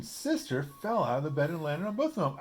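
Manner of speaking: ordinary delivery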